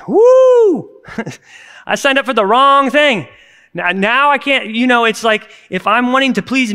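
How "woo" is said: high, loud whoop of shock; startled high-pitched whoop. Real, not acted. Reacting to scripture's challenge.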